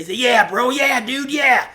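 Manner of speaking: high, loud chorus of yard buddies; agreeing homies. Acted. Homies backing up Polar Bear, eager high agreement. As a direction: chime in eagerly as the whole crew. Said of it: "all the other ones say".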